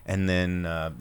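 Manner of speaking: ordinary talk, nothing marked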